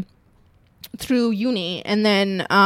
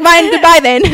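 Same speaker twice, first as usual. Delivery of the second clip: defiant reply; 6 semitones higher, 8 dB louder than usual. Retold or imitated.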